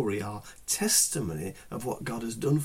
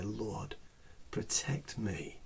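hushed plea; 2 semitones lower, 9 dB quieter, slower than usual. Pretend. Voicing a hushed cry for help.

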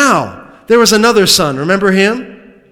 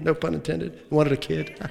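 ordinary talk

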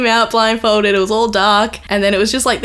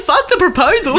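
higher, laughing giddy blurt; giddy mock outburst; 8 semitones higher, 3 dB louder than usual. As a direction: toss it off in laughing excitement. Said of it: "she's like".

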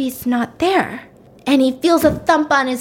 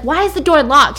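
louder, higher annoyed demand; acted irritation; 5 semitones higher, 5 dB louder than usual. Angry brother finds himself locked out, irritated. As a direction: demand it, raised voice, retelling his temper.

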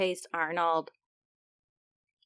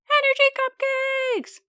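very high, squeaky, louder cheer; squeaky germ voice; 5 dB louder than usual. Acted.

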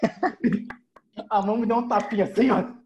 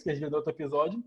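ordinary talk, nothing marked

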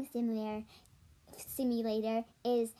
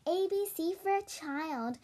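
put-on childish sing-song; 7 semitones higher than usual. Mocking childish players.

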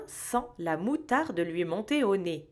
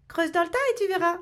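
fast, high secretive elf urging; mysterious; 8 semitones higher, 3 dB louder, much faster than usual. Acted. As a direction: tease him toward a secret. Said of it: "d'un air mystérieux". (d'un air mystérieux)

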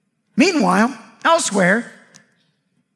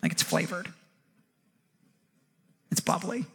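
mock sneer, softer; 6 semitones lower, 7 dB quieter, faster than usual.

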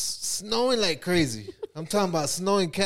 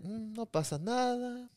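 put-on higher, softer, unbothered little girl; imitating Lily; 7 semitones higher, 8 dB quieter than usual. Little Lily in the back seat unbothered by the traffic, higher and softer. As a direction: lightly mimic a calm kid shrugging it off. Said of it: "And Lily's back there like".

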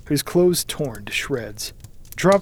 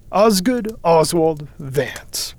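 slow, deliberate read-out of a full name; reading aloud; 3 semitones higher, 4 dB louder, much slower than usual. A narrator's reading. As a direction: announce each name formally from a ledger. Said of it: "he read aloud".

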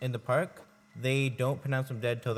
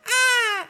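high, loud mock scream; imitated kid's scream; 11 dB louder than usual. Imitating the kid at Disneyland, mock screaming. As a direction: let out a short piercing kid's shriek.

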